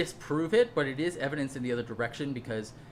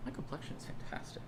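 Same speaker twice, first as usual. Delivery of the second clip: whispered aside; 12 dB quieter than usual. Real, not acted. Quiet self-satisfied aside.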